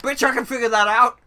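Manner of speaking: loud, high-pitched exasperated retort; mock outburst.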